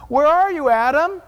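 loud call into the garden. Acted. God calls out to hiding Adam, loud call. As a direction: call out across the trees.

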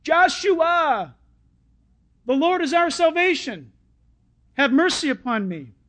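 loud, high shout for mercy; crying out. Acted. Beggar crying out to Jesus for mercy, shouting. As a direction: shout it out as a plea. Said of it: "He cries out".

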